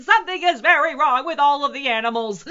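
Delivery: very high, loud alarmed cry; frantic zookeeper's yell; shouted. A narrator's reading. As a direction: shout it in a panic. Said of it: "he shouted".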